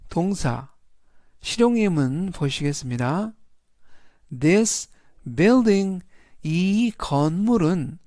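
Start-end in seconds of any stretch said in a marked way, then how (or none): 4.4-6.0 영어 단어를 또박또박 읽기; English model reading. Shown as a demonstration. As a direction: read each English word clearly. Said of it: "전체적인 의미는". (영어 단어 또박또박 읽기)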